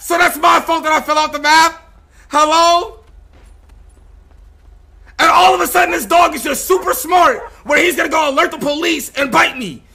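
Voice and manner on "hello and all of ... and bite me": ordinary delivery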